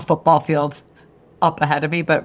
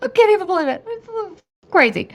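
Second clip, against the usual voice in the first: much higher, hammed-up fake sobbing; mock crying. To her husband filming her on the slope, mock crying. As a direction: wail it like a theatrical mock cry.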